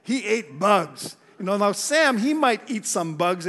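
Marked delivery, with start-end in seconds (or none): 0.0-1.2 lightly voiced admiring crowd, slow at the end; a touch of mock awe. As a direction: drawl out the admiration a little mockingly. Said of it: "A lot of people".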